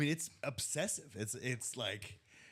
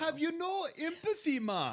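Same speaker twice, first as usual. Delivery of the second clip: high-pitched mock Jamaican lilt; hammed-up Jamaican accent, breaking toward a laugh; Kamala's Jamaican accent; 4 dB louder than usual. Co-host mimicking a politician's accent for laughs, mock Jamaican. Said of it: "Anytime a Kamala accent comes out".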